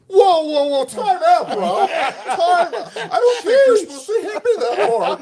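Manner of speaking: high, loud, laughing whoa time out; hammed-up frightened captive; mock panicked plea. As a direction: play scared and pleading through laughter. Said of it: "I went from all hardcore to".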